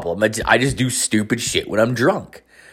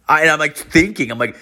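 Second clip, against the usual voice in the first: usual speaking voice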